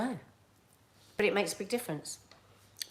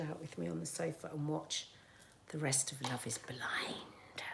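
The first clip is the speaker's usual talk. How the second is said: whispering; 3 semitones lower, 14 dB quieter than usual. Real, not acted. Secretive aside about show.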